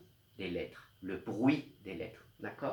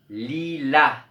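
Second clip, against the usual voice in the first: lilas with a loud final la; stress on the rhyme; 2 semitones higher, 8 dB louder than usual. Shown as a demonstration. Teacher showing that lilas ends in la, final syllable loud. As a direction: lean on the end of lilas. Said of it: "On entend le même son".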